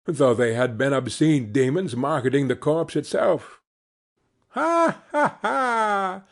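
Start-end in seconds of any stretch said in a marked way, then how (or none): 4.5-6.2 shrill high cackle; cackling laugh. A narrator's reading. The woman over their spoils as Joe counts money, cackling. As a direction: cackle gleefully over the loot. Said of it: "laughed the same woman".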